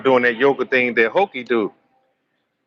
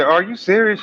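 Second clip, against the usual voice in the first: mock shock; 9 semitones higher than usual. Shocked reaction in retold scene.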